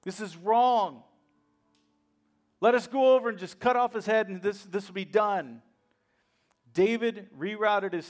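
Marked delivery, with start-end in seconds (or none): 0.0-5.6 louder, indignant mock outburst; emphatic imitation of angry men; retold anger. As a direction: act out an outraged follower demanding action. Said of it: "angry these guys were".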